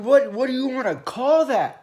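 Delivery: ordinary delivery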